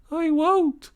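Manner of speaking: high, meek put-on voice; washerwoman's voice. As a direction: promise meekly. Toad as washerwoman.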